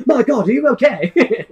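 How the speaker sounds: high, loud cry through laughter; gasp-and-rush rescuer, played for laughs; mock concern. Joking rescuer to a stranded guard, mock concern.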